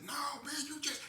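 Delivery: refuse, terrified; fearful refusal. Retold or imitated. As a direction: accomplice refusing to drive.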